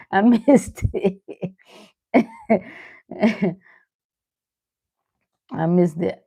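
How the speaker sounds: ordinary talk, nothing marked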